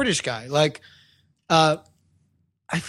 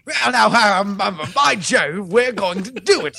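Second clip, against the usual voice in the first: hammed-up British gent, raised pitch; mock British accent; 3 semitones higher, slower than usual. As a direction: do a blustering, stammering British toff. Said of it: "the British guy doesn't get".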